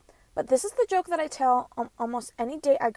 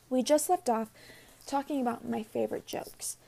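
ordinary talk, nothing marked

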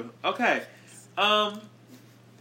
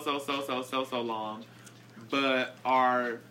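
normal talking voice

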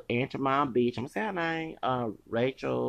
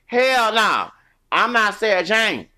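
loud, higher defiant declaration; defiant shout; 6 semitones higher, 7 dB louder, slower than usual. Real, not acted. Refusing to cry like Sarah Jane, a loud defiant shout.

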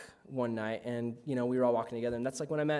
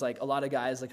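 ordinary delivery

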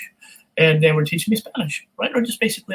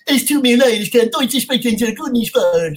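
goofy moose voice speaking German, higher and louder; German Bullwinkle; 4 semitones higher, 4 dB louder than usual. Shown as a demonstration. Voice actor showing how he dubs Bullwinkle into German, goofy. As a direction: do Bullwinkle with a thick German flavor. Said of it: "a German Bullwinkle".

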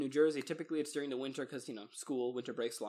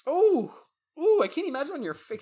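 much higher and louder, horrified; horrified gasp, louder; shocked wince; 11 semitones higher, 10 dB louder than usual. Real, not acted. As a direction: recoil at the story of the bites. Reacts to face bites.

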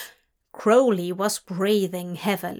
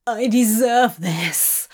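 emotional cry, a little louder; cried out; 4 dB louder than usual. A narrator's reading. Demon accepting his worth, crying out. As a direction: cry it out with conviction. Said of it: "he cried".

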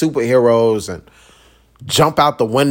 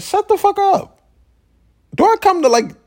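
exasperated yell; 3 dB louder than usual. Real, not acted. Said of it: "sudden high outburst".

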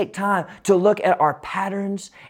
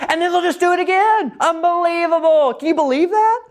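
high, louder mock outrage; exasperated disbelief; 14 semitones higher, 3 dB louder than usual. Real, not acted. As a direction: throw up your hands in comic disbelief. Dad exasperated at sons repeating.

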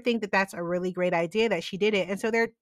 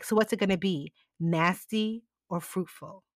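mock seductive; 4 semitones lower, 6 dB quieter than usual. Jack flirts with Prue.

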